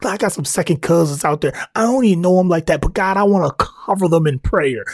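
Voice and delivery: ordinary talk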